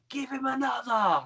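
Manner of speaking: high, drawn-out fan's call; heckler in crowd. Retold or imitated. Some guy in the crowd egging on the wrestler, mock high call. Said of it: "Some guy in the crowd was like".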